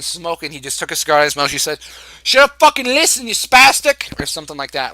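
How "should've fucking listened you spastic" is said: loud, high mock Italian-American accent; attempted Italian-American accent. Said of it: "like in that wap voice".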